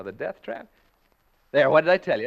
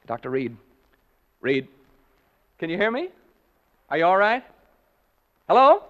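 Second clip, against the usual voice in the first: calling out; 3 semitones higher, 10 dB louder than usual. Acted. Announcer calling up to the silent doctor. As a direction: shout up to the room above.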